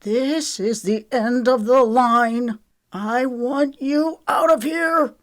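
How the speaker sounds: high, slow, emphatic dismissal; indignant. A narrator's reading. Trent orders Lexi out, indignant. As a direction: lay down the ultimatum, rising in pitch. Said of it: "indignation glowing hot in his eyes".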